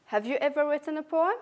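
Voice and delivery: "Have" reduced and quick, fast; weak "have". Shown as a demonstration. Teacher drilling students in weak-form "Have". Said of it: "the pronunciation here is weak".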